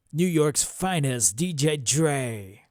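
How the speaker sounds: slow, loud radio DJ drop. Shown as a demonstration. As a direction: hype DJ announcer voice. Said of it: "So I'm gonna voice a drop".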